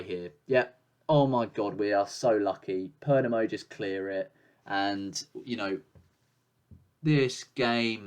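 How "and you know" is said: usual speaking voice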